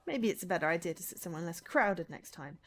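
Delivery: ordinary delivery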